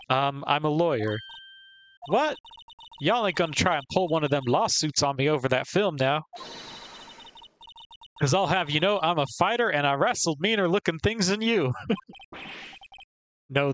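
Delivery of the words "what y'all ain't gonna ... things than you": higher, twangy country scold; Southern woman scolding. Acted. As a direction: chew them out like a country gal. Defensive Southern photographer.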